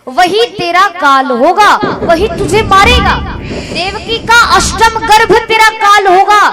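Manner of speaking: heavenly proclamation. Retold or imitated. A voice from the sky warns Kans of his death, solemn proclamation. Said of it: "उसी समय आकाशवाणी हो गई".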